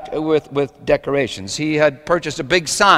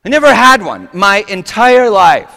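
high, loud plaintive cry, acted out; wounded outburst; 9 semitones higher, 9 dB louder than usual. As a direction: imitate a hurt woman owning up. Hurt birthday woman's complaint.

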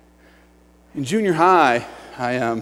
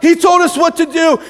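high, loud mock excitement; hammed-up crowd cheering its pastor; adoring congregation; 8 semitones higher, 4 dB louder than usual. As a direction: gush as a whole hyped-up church. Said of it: "the church is like".

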